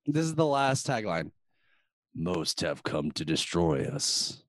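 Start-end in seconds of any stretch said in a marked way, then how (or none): 2.1-4.4 lower, slow ominous read; dramatic trailer narrator; movie trailer voice. Acted. Reading tagline dramatically.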